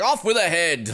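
lightly hammed dictator decree; mock tyrant. Tyrannical leader condemning her, mildly hammed command. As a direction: raise the pitch for a mock execution order. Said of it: "this tyrannical dictator leader guy".

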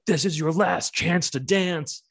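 high, slow mock stage announcement; imitating band's singer. Singer opening the set at a metal fest, high drawn-out mock announcement. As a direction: draw it out like a singer addressing the crowd. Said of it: "he's like".

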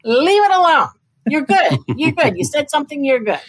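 high, soothing big-sister voice telling her to drop it; reassuring sister. Retold or imitated. Sister calming her down about the book, high reassuring voice. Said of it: "My sister's like".

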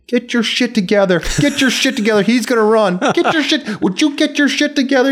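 loud, high frantic yell; retold yelling. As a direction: bark it like a guide losing patience. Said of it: "he's yelling at me".

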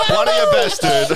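high, loud laughing praise; laughing hard at a friend's joke. Real, not acted. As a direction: crack up while praising the joke. Cracking up at a joke.

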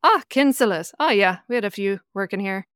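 bright Irish recognition, mildly hammed-up; Irish accent. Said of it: "Well done on the accent".

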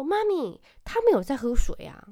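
put-on high, loud child insisting; a three-year-old loudly defending himself; loud earnest child. Son loudly explaining to mom, in a high insistent child voice. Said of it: "那边跟我大声说".

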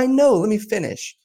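high-pitched plea to be heard; retold placating interruption. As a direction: replay heading off an objection, voice jumping up. Retells cutting off Texan's protest.

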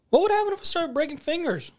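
high, lilting imitated menace; mock-innocent threat. Captor threatening the captain's friend, mock-innocent high voice. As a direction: pose the threat as an idle question.

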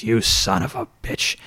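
slow, raspy wrestler imitation; Luna. Wrestler curses the rookie who clubbed her, slow throaty imitation. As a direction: draw out the retold curse in a throaty rasp. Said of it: "Luna has this raspy, throaty voice".

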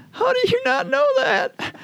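very high, disbelieving Southern woman; Southern mom shocked he hasn't heard; Southern accent, incredulous. Retold or imitated. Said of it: "they had Southern accents".

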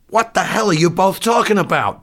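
high, loud, shrill outburst; shrill cry. Acted. Doctor facing disaster cries at his men, shrill. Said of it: "Doctor Nika cried shrilly".

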